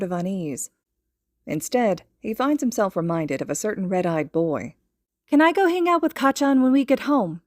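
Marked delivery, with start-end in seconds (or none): 5.3-7.4 put-on high, louder, blurted child question; abrupt eager ask. A narrator's reading. Little boy abruptly asks his mother to visit his friend. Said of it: "he asks abruptly".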